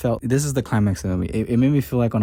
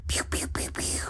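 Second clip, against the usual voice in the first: breathy, whispered laser-blast noises, no voice; play-acting the jets firing, just air; whispered pew pew; 10 dB quieter than usual. Describing the dogfight, whispering blaster sounds.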